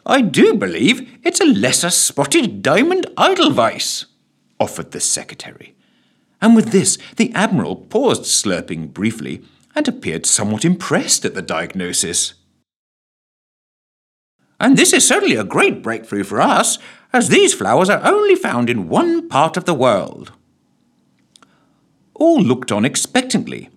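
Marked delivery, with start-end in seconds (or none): 0.1-4.1 high, fussy official voice; confident guess as the secretary; pompous minister naming a flower. Acted. Secretary character voice. 14.6-20.3 high, excited official voice; secretary. Acted. Secretary character voice.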